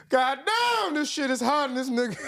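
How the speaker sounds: high, loud cry of heat-struck frustration; exasperated outburst. Retold or imitated. Preacher curses the heat in church, loud high outburst. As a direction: blurt it out like the heat finally broke him. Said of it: "but he said".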